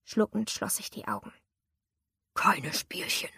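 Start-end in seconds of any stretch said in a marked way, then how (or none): 2.3-3.3 low whispered threat; whispered warning. A narrator's reading. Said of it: "warnte mich mein Peiniger".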